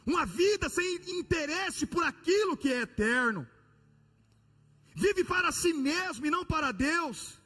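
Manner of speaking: high, loud, impassioned sermon; fiery preaching. Acted. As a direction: preach it with rising fire. Preaching at full force.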